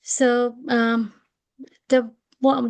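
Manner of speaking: breaking up over a bad line; garbled video call audio; bad connection. Real, not acted. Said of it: "my connection has been really bad".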